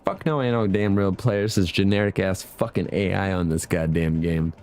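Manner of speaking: usual speaking voice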